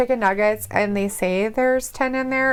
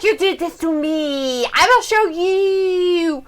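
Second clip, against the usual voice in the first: slow mock vengeful voice; 4 semitones higher, much slower than usual. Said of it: "He's like".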